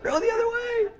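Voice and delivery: mock terrified shriek, very high; high-pitched panicked movie-goer yell; scream. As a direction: scream like you're watching a scary movie. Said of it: "I scream a lot".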